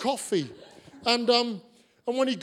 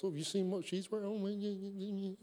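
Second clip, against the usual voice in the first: hushed, fast gossip chatter; gossiping friend; 10 dB quieter, faster than usual. Retold or imitated. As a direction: lean in and dish the gossip quickly. Mimicking gossip between friends.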